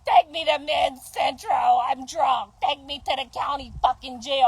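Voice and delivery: mocking a drunk girlfriend wailing to be taken to jail; mocking imitation. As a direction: ham up the drunk crying girlfriend. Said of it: "y'all be over there like".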